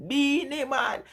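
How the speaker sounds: name lifted up high with delight; excited repeat. Real, not acted. Telling chat her favourite artist, excited and high.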